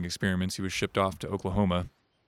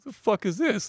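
indignant; 13 semitones higher, slower than usual. Retold or imitated. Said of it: "and I'm getting pissed".

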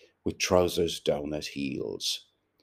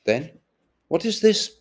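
sharp, much higher and louder demand; impatient; 12 semitones higher, 7 dB louder than usual. A narrator's reading. Buck Mulligan pressing Stephen for an answer, impatient and loud. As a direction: snap the question out, losing patience. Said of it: "Buck Mulligan asked impatiently".

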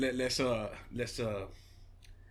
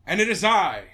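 wrestler entrance; 4 semitones lower, 7 dB louder, slower than usual. Pretend.

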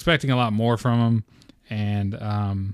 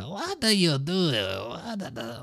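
high, strangled gremlin voice; mocking gremlin-like squawk; strained T'Challa impression; 6 semitones higher, 4 dB quieter, much slower than usual. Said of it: "like, a strain on his voice".

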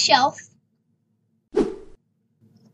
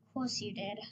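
muttering sarcastically under breath; muttered; 6 semitones lower, 17 dB quieter, faster than usual. Acted. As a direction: grumble it to yourself. Muttered reply after being overheard.

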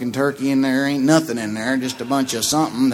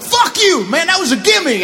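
high, loud furious yell at a golf buddy; shrill-free mock rage, loud and high; angry yell; 5 dB louder than usual. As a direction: snap it like a sore loser. Cutthroat golf buddies yelling.

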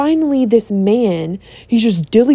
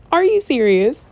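"Are you serious?" repeated through a laugh; laughing disbelief; 3 semitones higher than usual. Retold or imitated. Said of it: "I threw a fit".

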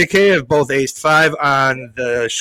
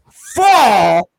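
ordinary talk, nothing marked

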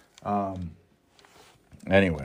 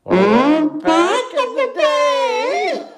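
high laughing; much slower than usual. Real, not acted. High laughing after the mock wail.

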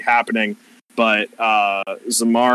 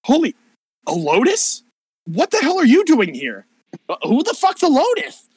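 much higher, startled exclamation; shocked to see an old friend; surprised Persephone; 13 semitones higher, 3 dB louder than usual. Acted. Persephone startled to find the player here, much higher.

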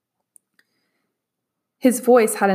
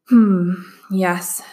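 considering; 3 dB louder than usual. Acted. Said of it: "obviously considering".

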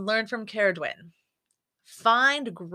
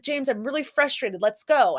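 a firm, louder retold scolding; frustrated mom; 5 semitones higher, 6 dB louder than usual. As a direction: mom retelling her frustrated push to leave, mildly louder. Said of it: "I was really frustrated with him".

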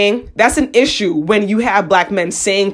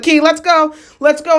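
higher-pitched, hurried friends' plea; imitated urging; 7 semitones higher than usual. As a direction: mimic friends pulling her away. Friends urge her to leave.

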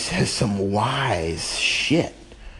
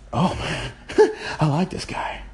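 lightly voiced fan, much higher with a laugh; imitating a delighted new listener; excited listener; 8 semitones higher than usual. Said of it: "and then they may say".

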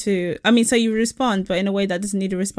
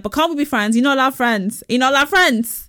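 mock the man's insistent pleading; raised, repetitive mock objection; mock protesting; 3 semitones higher, 4 dB louder than usual. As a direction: imitate his pushy protest, higher and a little louder. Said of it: "Like that".

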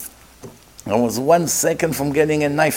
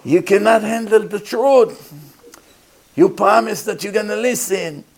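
retold scream; 6 semitones higher than usual. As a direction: yell him back down onto the couch. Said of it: "he started to scream".

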